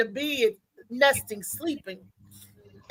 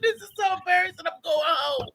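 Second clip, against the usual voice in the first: higher-pitched retold whimper; mock crying; 8 semitones higher than usual. Woman retelling her embarrassment to friends, mock crying. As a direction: act out an embarrassed, tearful wail. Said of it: "I'm crying".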